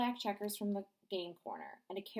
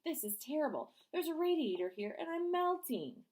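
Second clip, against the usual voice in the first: high, hammed-up melting wail; mock melting snowman; 8 semitones higher than usual. Danny clowns for classmates, lightly hammed-up melting. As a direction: ham up the melting.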